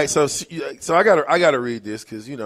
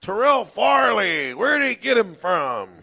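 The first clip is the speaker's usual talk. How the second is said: Keith Jackson; 6 semitones higher than usual. Retold or imitated. Host imitating the broadcaster calling Nebraska football, high announcer voice. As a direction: call it like an excited old-school sportscaster. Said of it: "and I like Keith Jackson".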